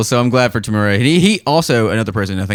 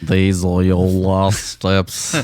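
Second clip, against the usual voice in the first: put-on very slow Liverpool-accented Obi-Wan; much slower than usual. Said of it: "our Liverpool Obi-Wan".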